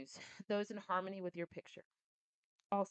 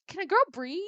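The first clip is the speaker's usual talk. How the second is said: higher, louder playful protest; mock indignant; 10 semitones higher, 10 dB louder than usual. Real, not acted. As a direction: demand to breathe in mock outrage. Mock protest about yawning.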